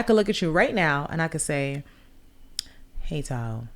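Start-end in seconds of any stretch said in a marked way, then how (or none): 3.0-3.7 low, soft greeting; a hushed low hello; soft hey. Shown as a demonstration. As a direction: say it quietly and low. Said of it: "Three different energies".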